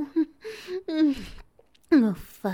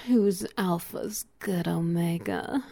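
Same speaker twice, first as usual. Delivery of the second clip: ordinary talk, nothing marked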